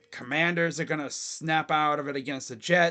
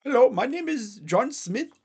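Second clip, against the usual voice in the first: mock scam caller; 6 semitones higher than usual. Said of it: "person being like".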